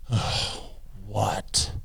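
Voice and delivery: breathy sigh into a soft question; exasperated reply. Acted. Woman fed up with the parrot, soft exasperated sigh. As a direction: groan out a worn-down answer, softly. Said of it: "She goes".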